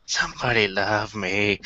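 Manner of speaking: slow, lightly voiced lonely lurker; mock needy. Joking about staring at the shout box, mock needy. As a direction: drag out a pretend plea for affection.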